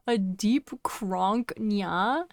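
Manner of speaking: puzzled. Real, not acted. Slow puzzled repeat.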